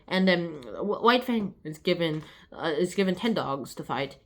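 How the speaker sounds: ordinary delivery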